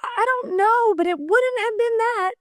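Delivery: high, tearful voice on the edge of crying; tearful pleading. Real, not acted. Mother imagining her own apology to her son, tearful pleading.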